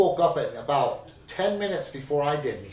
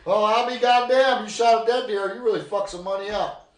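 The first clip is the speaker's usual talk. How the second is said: higher, louder mock amazement; mocking grandma; 6 semitones higher, 5 dB louder than usual. Grandma mocks grandpa with the cash, loud gloating. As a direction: gloat over the money like a grandma. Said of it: "She pulled 'em out and says".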